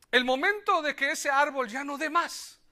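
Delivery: usual speaking voice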